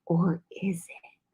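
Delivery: slow, hushed, mock-mysterious whisper; teasing whisper. Co-host challenging that it's too potent, teasing whisper. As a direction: whisper it slowly to cast doubt.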